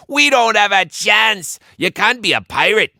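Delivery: fretting pirate. A narrator's reading. Frightened pirate laments.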